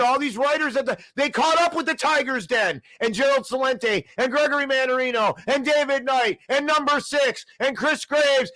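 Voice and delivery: angry rant. Real, not acted. Host railing at newspaper that finally caught up, angry rant. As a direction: fire it off high and indignant. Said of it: "it makes me so angry".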